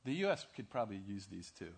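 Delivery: ordinary delivery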